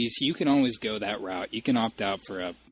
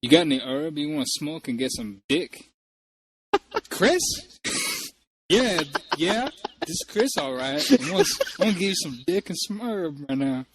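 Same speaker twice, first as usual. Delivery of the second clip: put-on getting drunker; 6 semitones higher than usual. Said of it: "prank call as Chris".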